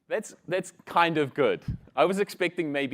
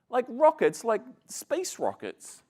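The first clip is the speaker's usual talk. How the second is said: put-on boastful Texan boy; 7 semitones higher than usual. Texan boy impressing Kiwi boy, high excited brag. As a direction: pitch it up with wide-eyed bragging.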